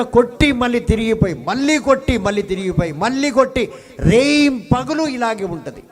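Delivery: fiery preacher pounding the scripture; preaching at full force. Acted. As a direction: hammer each word of the verse. Preacher describes crashing waves.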